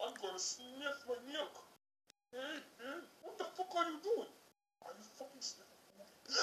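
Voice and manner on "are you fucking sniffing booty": whispering. Pretend. Doll play, sudden hushed question.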